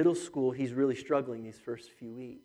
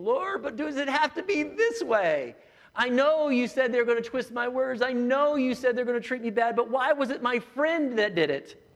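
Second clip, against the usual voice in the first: pleading prayer; 9 semitones higher, 7 dB louder than usual. Acted. Gethsemane prayer voiced to Lord.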